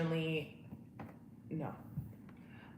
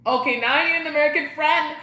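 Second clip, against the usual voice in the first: loud, high-pitched exclamation; excited outburst; 8 semitones higher, 10 dB louder than usual. Real, not acted. Reacting to a friend's email idea, excited. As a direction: burst out with it.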